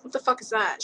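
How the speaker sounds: normal talking voice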